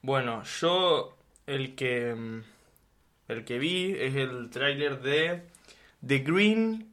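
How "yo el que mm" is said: ordinary talk, nothing marked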